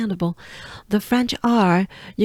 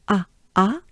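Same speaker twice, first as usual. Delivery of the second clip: normal talking voice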